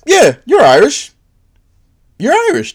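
lightly voiced high-pitched approval; easy agreement.

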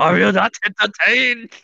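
high mock Chinese-dubbed gladiator; Russell Crowe. Redoing the movie line as a dub, high mock Chinese accent. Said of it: "That's a Russell Crowe Chinese dub".

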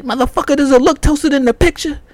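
retelling his angry shout at the sandwich clerk; furious retort. Retold or imitated. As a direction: blow up at him in disbelief.

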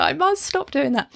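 ordinary delivery

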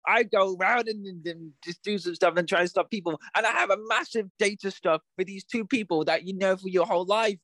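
high, hammy babbling parody; mock character voice. Host parodying a character's confusing exposition, high hammy babble. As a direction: rattle off the plot like a silly character. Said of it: "he was like".

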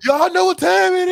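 high, very loud hype-man shout; shouted catchphrase. Retold or imitated. Co-host breaking into R-Truth's entrance line, shouted high. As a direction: belt out the catchphrase like a wrestler entering.

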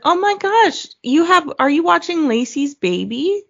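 high-pitched excited imitation; gushing stranger. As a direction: gush like a delighted acquaintance. Voices gushing stranger at park.

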